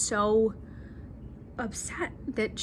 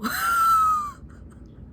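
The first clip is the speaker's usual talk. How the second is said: high, far, delighted laughing cry; shrieking laugh. Real, not acted. Vlogger squealing at a gorgeous mountain shot, laughing. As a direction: let out a squealing laugh at the view.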